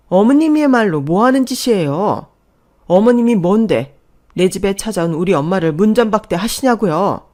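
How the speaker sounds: loud, indignant challenge; angry retort. Acted. Daughter-in-law defends her mother turned away at the door, furious. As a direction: shout back, demanding an answer.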